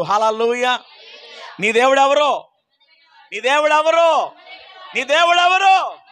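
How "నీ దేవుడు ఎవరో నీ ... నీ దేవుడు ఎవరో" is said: each repeat louder; building shout. Acted. Preacher challenges the congregation, building to a shout.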